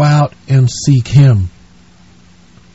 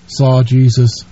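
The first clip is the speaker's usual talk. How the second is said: normal talking voice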